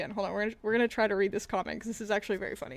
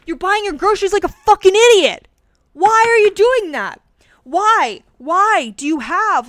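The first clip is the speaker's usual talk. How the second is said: loud, exasperated yelling; furious ranting yell; angry rant; 7 semitones higher, 12 dB louder than usual. Real, not acted. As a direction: shout your disbelief at them. Ranting at normie posters.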